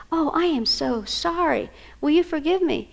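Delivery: modeled apology. Shown as a demonstration. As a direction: plead sincerely, modeling for a child. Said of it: "I want to model being sorry".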